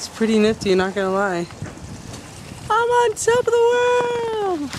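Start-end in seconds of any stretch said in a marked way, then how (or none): 2.7-4.7 high, drawn-out triumphant cry; exultant holler. Real, not acted. Visitor atop the castle, exultant drawn-out holler. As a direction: holler it slowly, far above your usual pitch.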